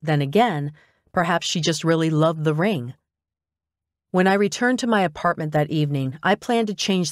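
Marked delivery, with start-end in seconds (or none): none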